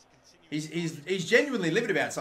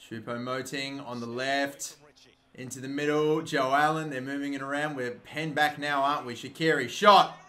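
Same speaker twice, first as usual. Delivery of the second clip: ordinary delivery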